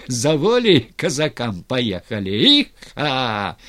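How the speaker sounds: loud rallying cry with a riding whoop; yelling. A narrator's reading. Styr riding Razin off after freedom, yelling. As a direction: call out as if urging on a horse. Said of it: "орал Стырь".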